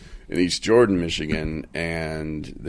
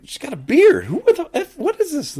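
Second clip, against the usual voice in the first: very high, loud disbelieving squeal; freaking out; 7 dB louder than usual. Retold or imitated. [name] seeing the bearded lady, high and loud. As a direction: shriek in total disbelief. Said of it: "I'm like".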